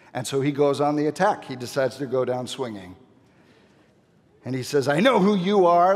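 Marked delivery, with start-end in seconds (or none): 4.9-6.0 louder, higher mock challenge; defiant accusation. Demon attacks Jesus by naming him, defiant. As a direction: go down swinging as the demon.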